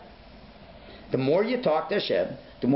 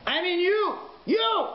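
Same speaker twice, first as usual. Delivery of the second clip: booming announcer call; slower than usual. Retold or imitated. Stadium announcer singling one man out of sixty thousand, shouting. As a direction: call him out over the loudspeaker. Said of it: "You hear the voice".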